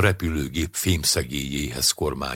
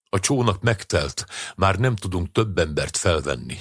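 ordinary delivery

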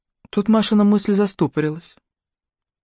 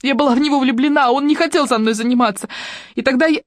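high, distraught female voice; anguished, pitched-up admission; desperate confession; 8 semitones higher than usual. A narrator's reading. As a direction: pour out the confession, upset. Sobbing Motya goes on confessing.

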